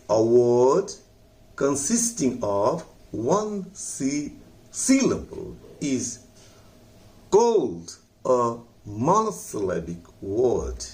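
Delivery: each English word drawn out; slow dictation. Shown as a demonstration. Teacher dictating a definition, very slowly. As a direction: read it slowly for students to copy. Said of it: "teacher dictating definition".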